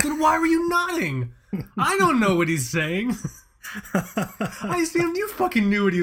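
high, loud disbelieving yell at his dad; exasperated outburst. Retold or imitated. Son yelling at dad who nodded along, high and exasperated.

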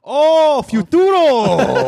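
put-on very high, loud, drawn-out Japanese-accented cry; mock angry Japanese accent. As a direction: shout it like an over-the-top Japanese DJ. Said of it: "like an angry Japanese guy".

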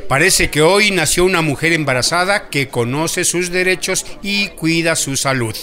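usual speaking voice

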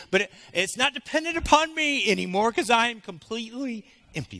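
impassioned spoken prayer; fervent prayer. Acted. Prays aloud at full force.

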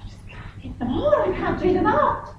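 high-pitched whine of defeat; hammed-up helpless wail, high; mock despair. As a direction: imitate a daughter whining in frustration. Daughter despairing over tight jeans.

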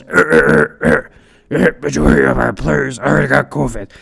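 very low, growled Cajun coach impression; deep-voiced Cajun coach, badly imitated; terrible Cajun accent. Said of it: "this is my terrible Cajun accent of deep voice that he has".

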